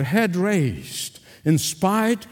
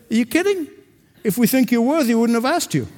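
pitched way up in disbelief; incredulous retort; 6 semitones higher, faster than usual. Retold or imitated. Preacher retelling his reply to a falsely humble man, incredulous and high. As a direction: scoff at the idea, high and amused.

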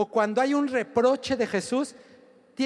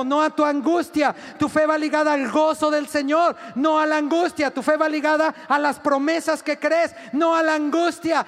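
higher, louder preacher's cadence; full-force preaching; 4 semitones higher, 3 dB louder than usual. Acted. Preacher exhorting the congregation, louder and higher. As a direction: drive each line home to the crowd.

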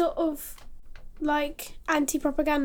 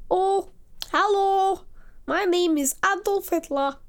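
mock German accent; 5 semitones higher than usual. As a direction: ham up a German-accented Hitler introduction. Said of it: "that accent is from Lower Silesia".

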